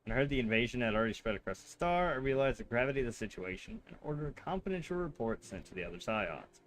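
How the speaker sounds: even, measured read of the NPC lines; reading game dialogue. Acted. Streamer reading a sister's lines to chat, calm and even. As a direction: read the character's lines calmly, without much acting.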